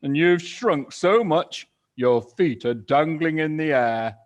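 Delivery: loud mocking cry. Mister Twit jeering at his wife, loud mocking cry. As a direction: jeer at her loudly. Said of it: "he cried".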